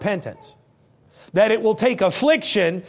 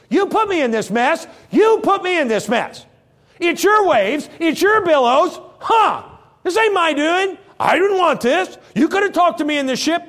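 higher, louder blaming outburst; accusing rant; 8 semitones higher, 4 dB louder than usual. Acted. Jonah to God, loud accusing rant. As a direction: throw the blame back at God.

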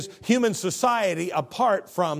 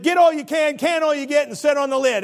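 worldly slogan, pitched up and louder; higher, louder mock of the world's creed; 10 semitones higher, 7 dB louder than usual. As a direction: throw it out like the world's motto. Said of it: "that's what the world says".

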